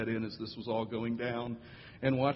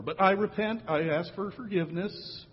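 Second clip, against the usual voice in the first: put-on high, louder kid's plea; child apologising to friend, pitched up; mock child pleading; 8 semitones higher, 3 dB louder than usual. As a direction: beg like a sorry little boy. Child voiced repenting.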